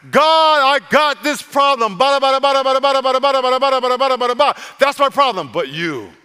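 loud, high wailing gibberish complaint; mock whining complaint. Shown as a demonstration. Preacher mocking a complainer to God, loud high whine. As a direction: whine out the problem like a broken record. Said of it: "Here is how you complain to God".

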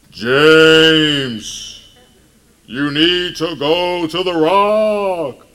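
booming, drawn-out proclamation; mock voice of God. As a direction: boom it out like a hammed-up God. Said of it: "that's what God's voice sounds like".

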